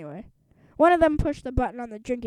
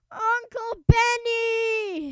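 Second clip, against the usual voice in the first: high, slow, sobbing call; a shivering boy crying for his uncle; tearful howl; crying wail; 11 semitones higher, 5 dB louder, much slower than usual. Acted. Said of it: "he wailed".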